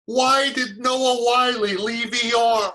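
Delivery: high, drawn-out shouted question; imitated yelling. Homeless man demanding a TV answer from the actor, imitated yell. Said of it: "he started yelling".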